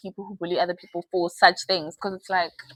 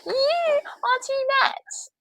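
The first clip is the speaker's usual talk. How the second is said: high, slow mocking man's voice; slower than usual. Imitating a man telling women they are too much, high and slow. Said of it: "don't let nobody fool you into saying".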